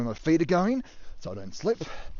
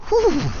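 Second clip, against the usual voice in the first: high pleased exhale; satisfied whew; 7 dB louder than usual. Real, not acted. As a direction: breathe out in relief. After a cold beer.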